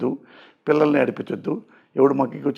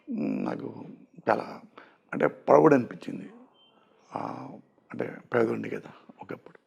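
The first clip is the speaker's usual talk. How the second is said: much softer, lower, halting words; getting emotional; 3 semitones lower, 8 dB quieter than usual. Real, not acted. Guest remembers being poor, moved and much softer. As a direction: choke up quietly as the memory hits. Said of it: "emotional కూడా అవుతున్నారు".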